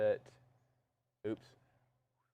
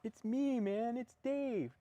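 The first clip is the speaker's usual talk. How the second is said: high stoner voice, laid-back; 13 semitones higher, slower than usual. Retold or imitated. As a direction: drawl it like a stoned comic. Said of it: "It's [name] and [name]".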